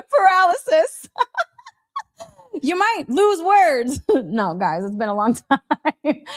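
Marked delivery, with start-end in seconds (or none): 0.0-4.1 laughing through the word Paralysis, higher and louder; laughing at own slip. Real, not acted. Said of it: "my funny pronunciation of words". 4.8-6.2 laughing. Real, not acted.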